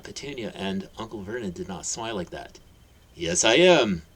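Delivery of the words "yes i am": louder, gentle assurance; kind reply. Acted. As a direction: answer kindly and proudly. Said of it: "replied kindly".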